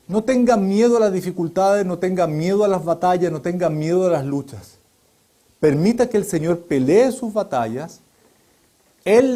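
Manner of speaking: rising, urgent preacher's appeal; preaching. Real, not acted. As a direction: preach it out to the flock, building then settling. Preacher exhorting the congregation.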